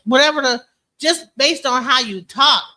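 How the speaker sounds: ordinary talk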